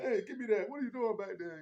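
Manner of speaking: hammed-up Joel telling her off; imitating Joel. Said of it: "He's like".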